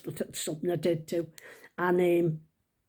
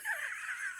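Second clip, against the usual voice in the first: high, quiet helpless laugh; 13 dB quieter than usual. Real, not acted. Storyteller amused by her made-up shop, high laugh. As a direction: laugh softly and high at yourself.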